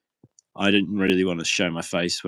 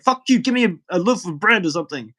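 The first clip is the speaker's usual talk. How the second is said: higher, louder angry yell; mock angry demand; 8 semitones higher, 4 dB louder than usual. Inmate yelling for bread, high and angry. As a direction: bark the demand at him. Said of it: "So he got really shitty".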